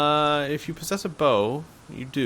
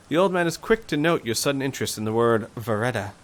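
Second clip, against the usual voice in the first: ordinary talk, nothing marked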